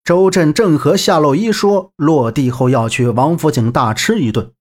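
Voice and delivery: usual speaking voice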